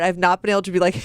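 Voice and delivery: ordinary talk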